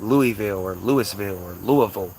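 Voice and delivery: Louisville three ways, LOO-ee-vill, LOO-iss-vill, LOO-uh-vull; wrong pronunciations. Shown as a demonstration. Rejecting outsider versions, Louisville as LOO-ee-vill, LOO-iss-vill, LOO-uh-vull. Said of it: "It's not".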